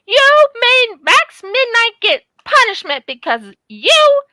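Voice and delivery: high, loud, indignant outburst at the bird; livid. Retold or imitated. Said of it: "I was livid".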